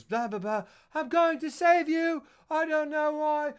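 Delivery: high, slow melodramatic wail; hammed-up damsel declaration; mock heroine voice. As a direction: pitch it way up and stretch the words. Acting out film heroine's lines.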